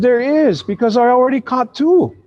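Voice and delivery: put-on high-pitched insistent child; little girl. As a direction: answer back as a little girl. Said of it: "She said".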